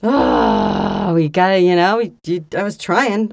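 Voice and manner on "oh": long, low groan; exasperated groan. Real, not acted. Storyteller teased about his embarrassing plan, groaning.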